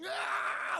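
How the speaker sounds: high monster cry; mock creature wail; creature screech. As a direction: imitate a monster shrieking in pain.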